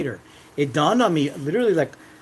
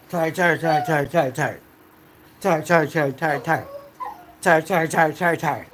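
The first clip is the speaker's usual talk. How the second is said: insistent repetition. Real, not acted. Drumming home how tired he was.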